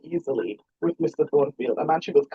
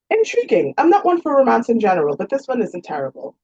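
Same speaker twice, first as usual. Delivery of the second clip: higher, lightly voiced young lady; lightly voiced genteel young woman; 7 semitones higher, 5 dB louder than usual. A narrator's reading. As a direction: play the heroine a touch higher and brighter in pitch. Regina voiced, female character.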